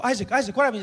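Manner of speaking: high, loud, worried call to Isaac; anxious calling. Retold or imitated. Brother arriving at hospital, calling out in alarm.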